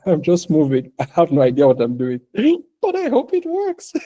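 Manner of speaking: amused mock confession; laughing while quoting. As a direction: laugh through the words, voice jumping high. Voicing an uncertain innovator, laughing.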